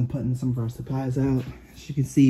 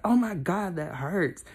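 retold pain; 5 semitones higher, slower than usual. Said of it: "then he poked me".

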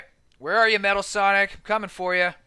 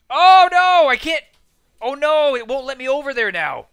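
loud, high wail of dismay; high-pitched gamer's dismay; dismayed outcry; 6 semitones higher, 7 dB louder than usual. Real, not acted. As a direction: cry out as it all goes wrong. Fails at a jump in game.

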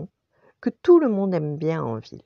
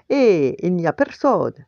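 louder storybook call; mild shout; 2 semitones higher, 6 dB louder than usual. A narrator's reading. Chef pretends not to see the dog, calling out. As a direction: call it out, lightly acted. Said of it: "cria le chef".

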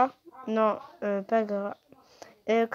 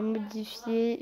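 normal talking voice

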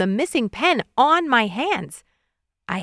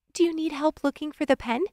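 higher-pitched helpful sister; lightly pitched-up sister character; sister's voice; 7 semitones higher, 4 dB quieter than usual. A narrator's reading. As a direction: offer help in a higher voice. Sister's line voiced in narration.

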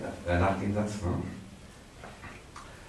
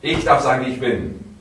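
louder, with force; powerful; 4 semitones higher, 10 dB louder than usual. Shown as a demonstration. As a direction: call it out with power. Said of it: "Nochmal, kräftig".

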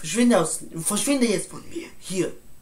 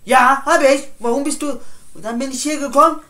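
higher and louder, fast at the end; heated shout; 6 semitones higher, 10 dB louder than usual. Acted. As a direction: push back in annoyance. Heated argument, raised voice. (gereizt und laut)

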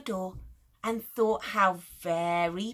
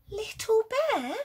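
much higher, loud startled call; surprised recognition; 11 semitones higher, 4 dB louder than usual. Acted. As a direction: realise who it is. Mummy recognises the bear.

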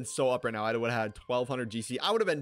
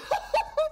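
real ecstatic laugh; high shrieking laugh; 3 dB louder than usual. Real, not acted. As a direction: squeal of laughter. Laughing after winning.

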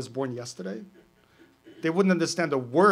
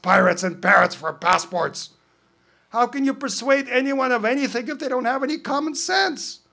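higher, louder, eager character voice throughout; bickering pirate and parrot; 7 semitones higher, 10 dB louder than usual. Acted. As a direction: argue like a cartoon pirate and his parrot. Pirate and parrot argue, performed.

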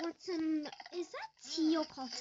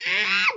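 loud panicked scream; a real cry of alarm at the game; 3 semitones higher, 12 dB louder than usual. Real, not acted. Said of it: "Sorry for screaming!".

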